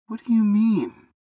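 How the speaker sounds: a frightened youth protesting to God; young Jeremiah. Acted.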